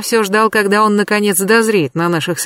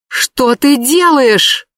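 high, slow alarmed shout; 6 semitones higher, 4 dB louder, much slower than usual. Acted. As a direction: cry it out in shock. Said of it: "крикнула Катя".

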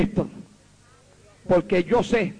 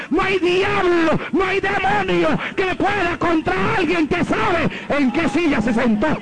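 fiery preaching; 8 semitones higher, slower than usual. Acted. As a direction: preach it at full force. Preaching at full force.

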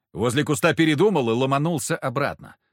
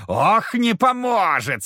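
higher, drawn-out devil voice, не stretched; slow gloating devil; 7 semitones higher, 4 dB louder, slower than usual. Acted. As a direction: savor it like a mocking demon. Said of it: "снова забормотал Фока".

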